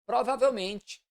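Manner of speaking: normal talking voice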